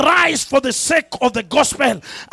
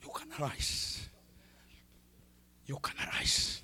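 softer, hushed whisper; 5 semitones lower, 9 dB quieter than usual. Real, not acted. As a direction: lean in and breathe the words. Preacher drops to an intimate hush.

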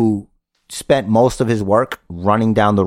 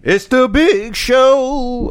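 announcer voice; 14 semitones higher, much slower than usual. Pretend. Announcer-style hype line.

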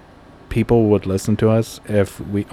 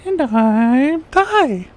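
high, very slow silly voice; drawn-out squeaky intro; just hit in the groin; 3 dB louder, much slower than usual. Pretend. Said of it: "you're Ty that just got kicked in the nuts".

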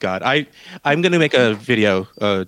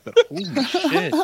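laughing. Retold or imitated. Laughing end of acted rant.

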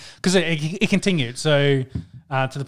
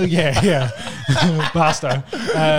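laughing; 3 semitones higher, 4 dB louder, much slower than usual. Real, not acted. Laughing at his own slip.

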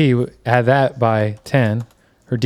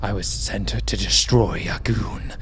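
dream spirit voice; 4 semitones lower, 6 dB quieter than usual. Acted.

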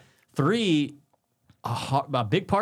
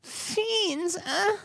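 high, soft falsetto; 4 dB quieter than usual. Acted. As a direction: squeak it up high.

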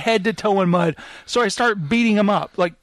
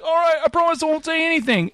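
high, rushed pleading promise; fast, pitched-up mock panic; threatened captive; 10 semitones higher, faster than usual. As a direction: babble it out to be let go. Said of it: "And he's like".

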